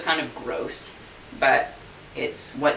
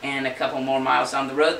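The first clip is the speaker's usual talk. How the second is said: usual speaking voice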